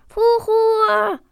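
high, loud call of a name; shout. A narrator's reading. Dragon calling his brother, loud high shout. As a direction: yell for your brother. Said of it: "Stattdessen schrie er".

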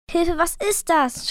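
understated panicky shout; mild frightened yell. A narrator's reading. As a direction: call out in alarm, not full force. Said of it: "schrie Liv".

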